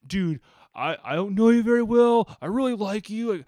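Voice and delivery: pleading. Retold or imitated. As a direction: beg him loudly, half desperate. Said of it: "is pleading with Tyler".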